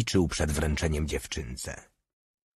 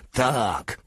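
shouted; 6 semitones higher than usual. A narrator's reading. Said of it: "krzyknął Malutki".

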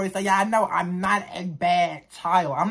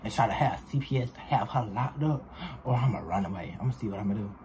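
inner thoughts; 9 semitones lower, 7 dB quieter, faster than usual. Retold or imitated. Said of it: "So I'm thinking, I'm like".

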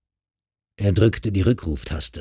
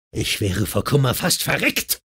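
higher-pitched angry outburst, not shouted; exploding in anger; 6 semitones higher than usual. A narrator's reading. Husband cuts off his wife's excuse, exploding in anger. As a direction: burst out with hurt indignation. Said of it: "explodierte Mark".

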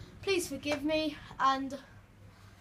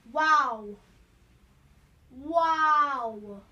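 loud, drawn-out disbelief; loud sarcastic wow; 12 dB louder than usual. Real, not acted. Gamer loudly reacts in disbelief after dying. Dies again, sarcastic exclamation.

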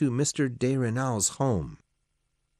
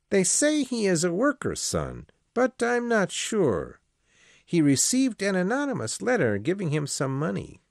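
a lightly voiced old priest, higher than the narration; Father Perard, raised pitch; 10 semitones higher than usual. Old priest refusing the Marquis's job offer, lightly voiced. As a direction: play the priest declining politely, pitched up.

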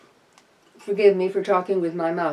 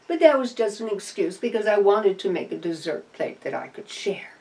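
ordinary delivery

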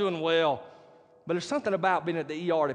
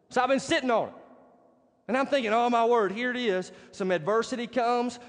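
raised, high-pitched preacher delivery; emphatic preaching; 7 semitones higher, 3 dB louder than usual. Real, not acted. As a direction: drive the message home to the congregation.

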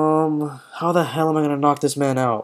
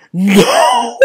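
high, loud wailed no; real frustrated howl; exasperated wail; 8 semitones higher, 6 dB louder than usual. Real, not acted. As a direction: cry out in disbelief. Reacts to attack doing nothing.

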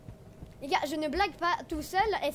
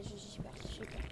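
frightened whisper, much softer; scared whisper; 8 semitones lower, 14 dB quieter than usual. Real, not acted. Scared alone in horror game.

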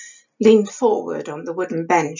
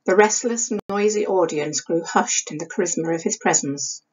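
plain reading voice

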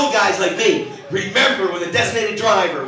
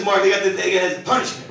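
ordinary delivery

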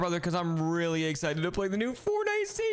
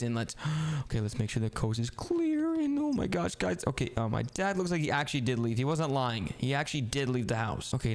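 ordinary delivery

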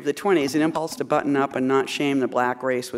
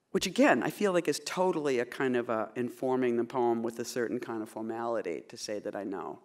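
ordinary delivery

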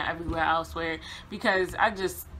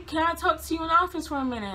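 manager's request; 10 semitones higher, faster than usual. Retold or imitated. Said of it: "John gonna come and say".

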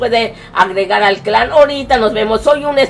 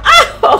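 a short, very high burst of laughter; quick gleeful high laugh; high giggle; 4 dB louder than usual. Real, not acted. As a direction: giggle with glee. Laughing while sending dance.

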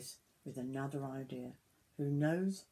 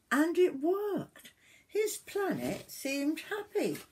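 lilting, higher storybook voice; story narration to children; 5 semitones higher, 8 dB louder, slower than usual. A narrator's reading. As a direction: read with drawn-out, emphatic key words.

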